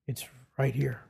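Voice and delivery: whispered "It's right here", breathy and soft; hushed reveal. Shown as a demonstration. Preacher showing his flock where Christ speaks, whispering. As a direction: lean in and whisper it. Pointing listeners to the Bible.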